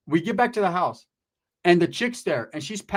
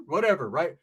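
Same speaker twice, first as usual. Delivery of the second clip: normal talking voice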